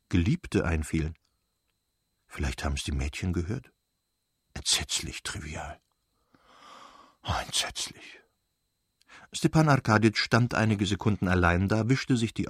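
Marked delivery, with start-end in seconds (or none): 2.3-8.2 low, soft, whispered shame; husband muttering in shame to himself; ashamed whisper. Acted. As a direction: hush it, afraid the girls heard. Husband ashamed, fears overheard.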